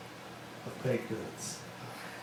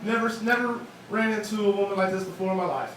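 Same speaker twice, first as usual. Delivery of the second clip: normal talking voice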